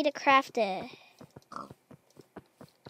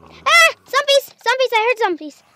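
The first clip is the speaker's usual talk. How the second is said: much higher, much louder panic at hearing zombies; panicked scream; 9 semitones higher, 11 dB louder than usual. Real, not acted. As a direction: shriek the alarm, then keep yelling. Sudden zombie sounds in game.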